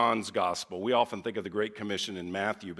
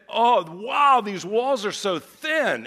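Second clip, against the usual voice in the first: high, loud wow, slow on the rest; retold startled exclamation; amazed; 9 semitones higher, 7 dB louder, slower than usual. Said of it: "So she said it".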